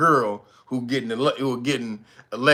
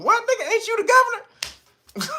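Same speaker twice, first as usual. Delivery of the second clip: high mocking "ain't you the governor"; incredulous questioner; much faster than usual. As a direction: squeak it in disbelief. Mock questioner confronting governor.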